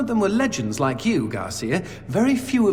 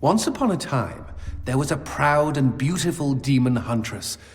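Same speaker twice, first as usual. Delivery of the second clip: hushed storyteller narration; spinning a tale for a companion; storytelling; 5 semitones lower than usual. Acted. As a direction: tell it like a fireside tale.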